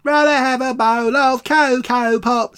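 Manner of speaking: high squeaky cartoon monkey; cereal mascot voice. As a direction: shout it like Coco the Monkey. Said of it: "talked like that".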